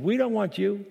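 mocking voice of the defiant world. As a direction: throw it up at God like rebels refusing. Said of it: "the world's looking up at God and saying".

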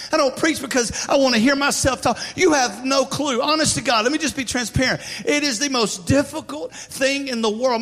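loud, high, driving sermon voice; fervent preaching. Real, not acted. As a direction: preach it with full force. Preaching at full force.

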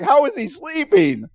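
stunned that anyone could sleep here; amazed disbelief. Retold or imitated. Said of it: "I'm like".

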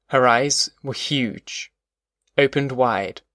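plain reading voice

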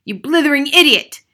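high, loud furious insult; raging headmistress shout. A narrator's reading. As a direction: hurl abuse at the boy. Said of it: "shouted the Trunchbull".

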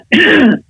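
small deliberate throat clear. Shown as a demonstration. Coach demonstrates loosening up before speaking, light throat clear. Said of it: "a clearing of the throat".

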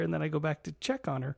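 ordinary talk

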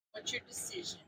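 soft whispered question; whispering. Real, not acted. Asks quietly.

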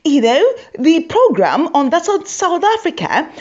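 high, prim Queen's English voice; posh critic. Retold or imitated. Comedian mocking a critic: a high, prim Queen's English voice. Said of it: "this is what he had to say".

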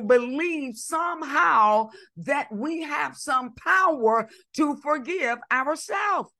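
forceful sermon with stretched key words; impassioned preaching. Real, not acted. Preacher correcting a teaching on self-forgiveness, impassioned. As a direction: drive the point home like a preacher.

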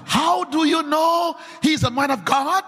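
ordinary delivery

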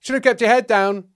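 loud, high call; heckler at the back. Acted. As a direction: pipe up from the back of the room.

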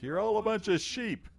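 high-pitched mock yell; retold yelling. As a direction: imitate a heckled performer yelling back. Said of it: "he starts yelling back".

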